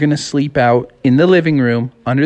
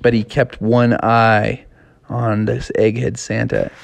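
ordinary delivery

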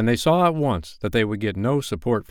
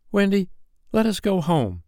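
put-on high-pitched plea from two little boys; lightly acted childlike call; children's cry; 10 semitones higher than usual. A narrator's reading. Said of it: "cried John and Michael together".

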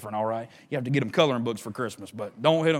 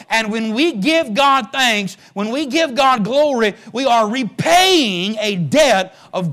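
full-force preaching; 11 semitones higher, 11 dB louder than usual. Acted. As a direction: drive each phrase home like a revival preacher.

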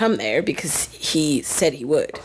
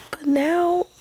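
high, breathless near-crying whisper; emotional near-sob whisper; choked up whisper; 10 semitones higher than usual. Real, not acted. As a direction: barely hold back tears. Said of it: "I'm gonna start crying".

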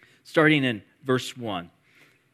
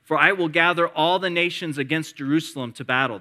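raised, ringing scripture read; 4 semitones higher, 4 dB louder than usual. Acted. Pastor reading prophecy aloud to congregation, proclaiming.

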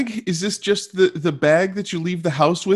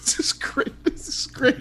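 whispering; 7 semitones higher, 7 dB quieter than usual. Real, not acted. Eager whisper before performing.

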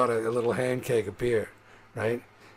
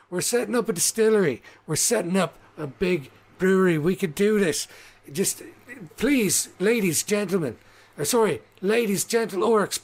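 high-pitched, hurried-sounding sales plea; pleading halfling showman; 7 semitones higher, 3 dB louder than usual. Acted. Halfling begging the orcs for a chance, high pleading pitch. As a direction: appeal over a rowdy crowd.

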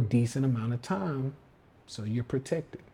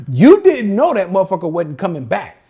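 laughing; 4 semitones higher, 10 dB louder than usual. Real, not acted. Laughing at a losing trade.

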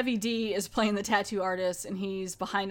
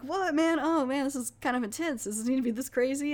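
higher, mock-panicked protest; retold freaking out; 5 semitones higher than usual. Tattooed guy mock-panics at the bloody tattoo, higher and quicker. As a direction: play a tattooed guy half-heartedly freaking out. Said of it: "like, freaking out".

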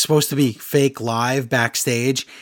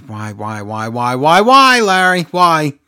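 exasperated plea; 4 dB louder, slower than usual. Real, not acted. To co-host Larry, despairing over the show, exasperated plea.

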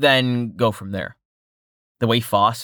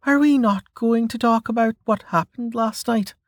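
put-on higher, dry teasing young woman; Melka voice; 13 semitones higher than usual. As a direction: tease him in a higher voice. Melka's voice in the story.

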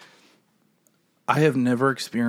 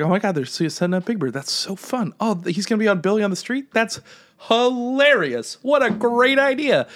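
gushing, pitch climbing higher; delighted; 7 semitones higher than usual. Retold or imitated. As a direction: gush with rising delight, stretching the last words. Acting out delight at seeing Big Bird.

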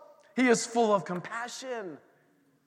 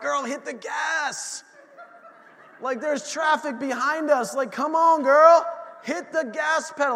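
urging shout at daughter; 6 semitones higher, 6 dB louder than usual. Retold or imitated. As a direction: hurry her up, voice raised. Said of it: "I'm like".

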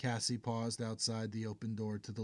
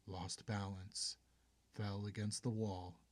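usual speaking voice

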